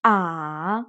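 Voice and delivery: "Ah" dipping low then up; third tone. Shown as a demonstration. Teacher drills the dipping third tone. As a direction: dip "Ah" low. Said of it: "Listen carefully and repeat".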